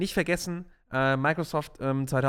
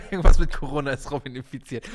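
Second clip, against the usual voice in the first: laughing; 2 semitones lower, 7 dB louder than usual. Real, not acted. Reads a teasing joke while laughing.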